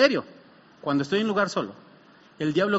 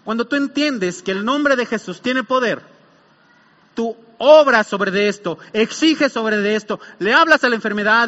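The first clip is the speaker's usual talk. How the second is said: impassioned preaching; 4 semitones higher, 7 dB louder than usual. Acted. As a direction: drive each phrase home to the congregation. Preaches at full force.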